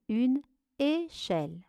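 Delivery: "échelle" split after the "é"; syllable by syllable. Shown as a demonstration. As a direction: leave a gap inside "échelle". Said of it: "La première syllabe". (« échelle » découpé en deux syllabes)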